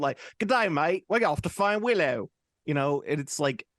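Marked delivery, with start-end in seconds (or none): 0.4-2.3 high, hammed-up Australian accent. Mocking a fantasy show's cast member, Australian accent. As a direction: put on a broad mock Aussie voice. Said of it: "a guy with an Australian accent".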